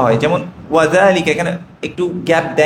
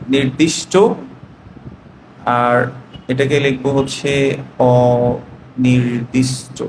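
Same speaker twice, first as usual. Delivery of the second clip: slow, lower voice, writing as you speak; dictating to the class while writing; slow dictation; 5 semitones lower, much slower than usual. Real, not acted. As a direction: drag the words out while writing.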